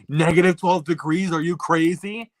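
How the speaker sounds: quoting the clip. Retold or imitated. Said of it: "when he's like".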